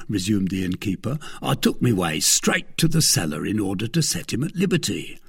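plain reading voice

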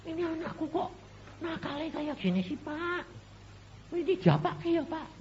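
high put-on voice of a fretting mother; mock complaining mother. Mother complaining to the pastor, high pitched put-on voice. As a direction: whine it like a worried mom.

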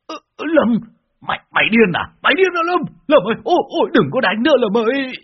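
very high, stammering yelps; panicked pleading. A narrator's reading. Master pleads with the disciple beating him, panicked. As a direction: beg frantically in a high, rising voice.

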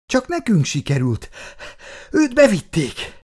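panting, voice climbing high; breathless and agitated after escaping; out of breath. A narrator's reading. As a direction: push the words out between gasps. Runaways still catching their breath.

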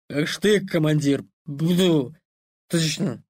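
put-on slurred drunken promise; tipsy soldier swearing loyalty; drunk rambling toast.

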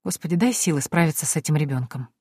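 ordinary delivery, nothing marked

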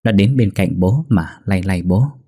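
ordinary delivery

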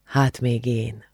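slow, soft narrated murmur. A narrator's reading. Attila answers the girl while they embrace, murmured. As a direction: draw it out quietly. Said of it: "mormolta Attila".

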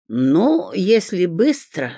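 drawn-out, put-on reluctance; feigned sigh. A narrator's reading. Bribed watchman relenting, affectedly sighing. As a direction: give in slowly with mock reluctance. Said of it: "деланно вздохнул румяненький старичок".

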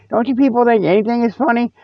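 ordinary talk, nothing marked

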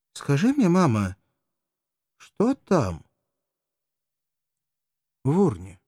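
put-on high-pitched child asking; a small girl wondering aloud; high childish question to mama; curious little girl. A narrator's reading. Child asks mother about the urn.

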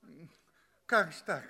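high, stammering protest; indignant outcry. Acted. Earth guest protests to the examiner, stammering and indignant. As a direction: burst out, flustered and stumbling. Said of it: "я вскричал".

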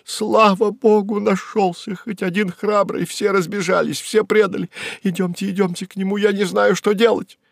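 high, frantic woman's voice; high-pitched panicked pleading without real shouting; distraught cry. Acted. Secretary clutching the accountant who just came in, distraught cry. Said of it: "стала трясти бухгалтера и кричать".

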